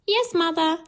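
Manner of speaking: put-on high, obedient little girl; high-pitched storybook child. A narrator's reading. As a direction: answer mother in a high child's voice.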